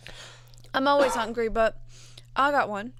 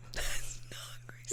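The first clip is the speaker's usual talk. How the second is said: whisper; 10 dB quieter, much slower than usual. Real, not acted. Whispered aside.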